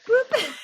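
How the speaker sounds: high loud laughing beep; phone beep. Retold or imitated. Imitating cordless phone sound.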